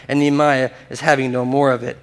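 ordinary delivery

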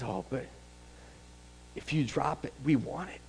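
soft humble plea. Acted. Preacher voicing the woman answering Jesus, hushed humility. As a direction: plead gently, almost under breath.

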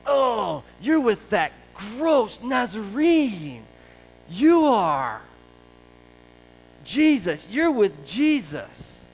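slow, loud, high-pitched sneer; the servant girl accusing Peter, sneering. Acted. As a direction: spit out Nazarene with contempt. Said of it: "hear the sneer in this young girl's voice".

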